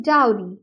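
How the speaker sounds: डाउरी with DOW like cow, then ree; correct pronunciation demo. Shown as a demonstration. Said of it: "जिसको डाउ जैसे pronounce किया जाता है".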